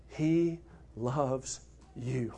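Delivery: very soft, slow and low; hushed tender reassurance to the church. Real, not acted. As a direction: let it land gently, almost to yourself. Tender aside to congregation.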